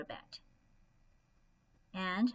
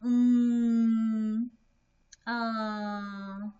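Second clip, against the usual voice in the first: hesitant filler; 8 dB louder than usual. Acted. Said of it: "Maybe someone said".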